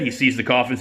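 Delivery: normal talking voice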